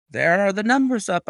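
high, loud call; excited cry. A narrator's reading. Narrator spotting the race numbers, crying out. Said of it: "I cried".

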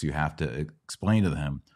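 normal talking voice

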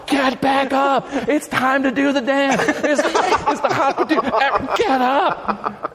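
high laughing mock yell; retold yelling. Friend yells at the audience to dance, high laughing yell. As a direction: shout it high through laughter. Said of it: "he starts yelling at everybody".

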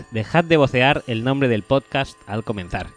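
normal talking voice

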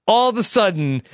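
usual speaking voice

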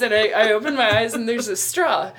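ordinary delivery

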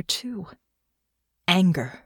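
ordinary delivery, nothing marked

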